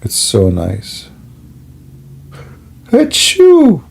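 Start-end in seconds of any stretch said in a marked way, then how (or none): 2.9-3.8 hammed-up fake achoo; fake sneeze. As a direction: sneeze on purpose, big and high. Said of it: "Allah is so happy".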